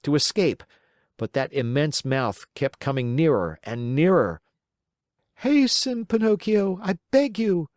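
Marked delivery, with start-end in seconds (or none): 5.4-7.7 much higher, pleading little goat voice; bleating goat. Acted. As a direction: beg him to hurry like a bleating goat. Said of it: "bleated the little goat".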